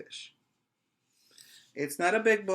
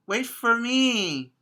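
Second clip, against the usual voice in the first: small fish begging, higher pitched; little fish pleading; 8 semitones higher, 4 dB louder than usual. Acted. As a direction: beg sweetly for a shiny scale. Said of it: "he called".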